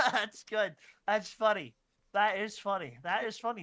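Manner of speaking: loud, laughing delivery; laughing at own joke. Real, not acted. Telling the group a silly Roman joke, laughing hard. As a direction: crack up and talk through the laughter.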